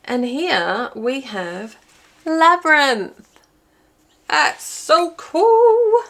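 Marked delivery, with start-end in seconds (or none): 2.2-6.0 high, loud, stretched-out exclamation; slow, soaring, delighted reveal; excited delight. Real, not acted. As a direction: draw it out, high and loud, thrilled. Delighted at the card matching.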